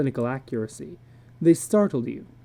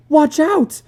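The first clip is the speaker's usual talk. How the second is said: much higher, louder panicked shout; screamed warning; 13 semitones higher, 9 dB louder than usual. A narrator's reading. Wife warning husband as the lions charge, screaming. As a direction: yell it as the lions leap. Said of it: "screamed Lydia".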